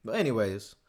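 winded after a long rant; breathless and a bit tired; out of breath. Real, not acted. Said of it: "I'm out of breath".

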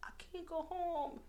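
high, soft, drawn-out whine; slow, small high-pitched refusal; retold helpless protest. Answering best friend from the work bathroom, helpless protest.

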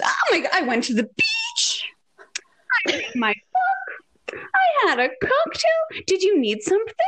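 very high, airy breezy chirp; imitated carefree mom. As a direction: breeze in high-pitched and oblivious. Imitates carefree mother back from beach.

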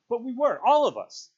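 high, very loud outburst; vehement protest. A narrator's reading. Ralph confronts Piggy about the dance, vehement. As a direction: forcefully insist on the guilt.

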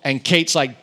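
ordinary delivery

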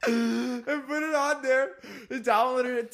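laughing. Real, not acted. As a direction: crack up as you say it. Laughing while telling the prank.

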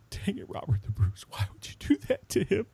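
gasp, then choked-up mock lament; mock restrained crying. Shown as a demonstration. Host showing how he cried at Braveheart, mock choked-up. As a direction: plead through pretend held-back tears. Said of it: "I'm talking about, like".

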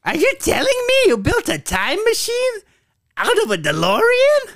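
very high screechy robot villain; very high, far-off villain voice; Starscream impression. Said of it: "That's Starscream".

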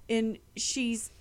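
usual speaking voice

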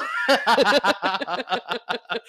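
high, loud burst of laughter; hysterical laugh. Real, not acted. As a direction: cackle helplessly.

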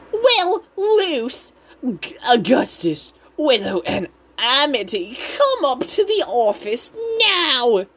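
high-pitched Karen mom voice; Odalia. Retold or imitated. Odalia calls the kids to the office, Karen voice. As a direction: summon them in a put-on, demanding high voice. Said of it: "that's not a Karen voice".

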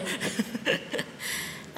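breathy trailing chuckle; soft, breathy laugh to yourself. Real, not acted. Speaker amused by own accent act, soft laugh.

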